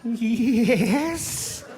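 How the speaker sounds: high, quavering, drawn-out Scottish mom; high, wobbly put-on phone voice; Missus Doubtfire. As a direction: answer as a fluttery Scottish matron. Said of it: "my mom sounds like Missus Doubtfire".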